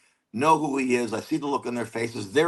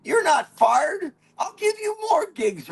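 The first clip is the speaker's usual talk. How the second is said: Southern drawl climbing much higher to the end; southern accent; 9 semitones higher than usual. Retold or imitated. Station owner cursing [name] to the young comic, southern accent. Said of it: "he had a southern accent".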